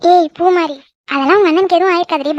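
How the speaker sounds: ordinary talk, nothing marked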